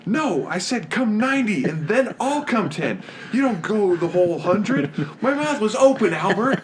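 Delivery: high, loud exasperated outburst; movie scene reenactment. Acted. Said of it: "let's hear it. Love this part".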